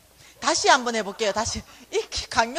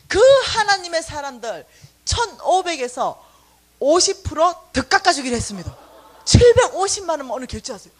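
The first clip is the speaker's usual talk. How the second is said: loud announcement; 2 semitones higher, 8 dB louder than usual. Retold or imitated. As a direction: announce it grandly. Manager announcing another discount. (지배인이 크게 할인을 발표하듯)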